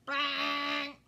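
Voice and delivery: loud harsh strum imitation; mock crash of a bad strum; loud bang. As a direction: clang it out badly. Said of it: "It sounded awful".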